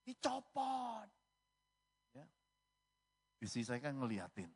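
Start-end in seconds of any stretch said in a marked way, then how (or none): 0.0-1.1 high, singsong nurse voice. Retold or imitated. Nurse telling male patient the catheter comes out, high singsong. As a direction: imitate a nurse, pitched high with a drawn-out last word.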